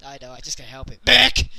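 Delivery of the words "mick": sudden loud barked name; Abyss impression. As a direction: bark the name out of nowhere. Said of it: "It's Abyss".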